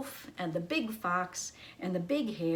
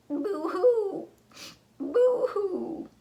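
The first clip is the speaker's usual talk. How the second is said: high, loud mock boo-hoo sobbing; hammed-up fake crying; fox crying; 12 semitones higher, 8 dB louder, much slower than usual. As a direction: wail like a storybook fox. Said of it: "began to cry too".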